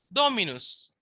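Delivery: "Dominus" said DOH-mi-nus, high on DO then falling; stress on first syllable. Shown as a demonstration. Teacher showing the antepenult accent, "Dominus" stressed on DO. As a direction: lean on the "Do" of "Dominus". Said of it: "the accent is on do".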